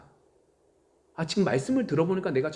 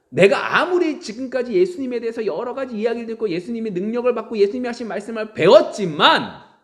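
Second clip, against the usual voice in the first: loud, high fervent preaching; preaching at full force; 8 semitones higher, 9 dB louder than usual. Acted. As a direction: build it into a fervent dream. (열정적인 설교)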